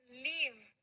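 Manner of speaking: लीव with a long, falling ee; long-vowel leave. Shown as a demonstration. Teacher answering how this word is said, लीव with long ee. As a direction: stretch the ee of लीव out clearly. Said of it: "इसको क्या बोलते हैं".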